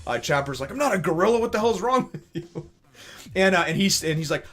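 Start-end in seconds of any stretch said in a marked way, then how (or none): 0.7-2.7 much higher, louder indignant yelp; indignant protest. Retold or imitated. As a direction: protest being called a gorilla. Chopper protests angrily.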